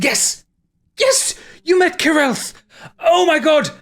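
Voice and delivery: a mad scholar who's cracked it; ecstatic breakthrough. Acted. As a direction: manic cries of discovery. Sebastian's breakthrough, frenzy.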